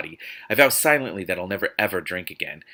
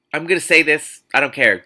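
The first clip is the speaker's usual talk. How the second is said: loud, high exasperated declaration; heated rant; 8 semitones higher, 9 dB louder than usual. Real, not acted. Reader, fed up with the author, rants loudly. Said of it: "ranting about the writer".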